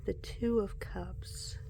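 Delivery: slow whispered card reading. Real, not acted. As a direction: hush it, as if not to wake anyone.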